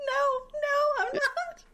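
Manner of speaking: barely able to speak for laughing; crying with laughter. Real, not acted. Host answering her co-host while crying with laughter. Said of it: "Are you okay?".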